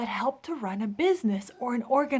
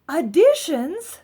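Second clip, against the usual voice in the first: slow, drawn-out, louder rising question; puzzled question; 7 semitones higher, 9 dB louder, much slower than usual. Acted. Amelia puzzled at the builders' word, slow rising question. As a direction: stretch it out, baffled.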